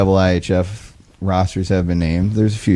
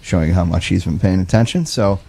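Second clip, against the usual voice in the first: ordinary delivery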